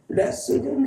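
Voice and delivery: 레스룸 stretched out, pitched high; slow model pronunciation. Shown as a demonstration. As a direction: model 레스룸 slowly for repetition. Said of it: "레스룸 해봐요". ('레스룸'을 높고 길게 발음)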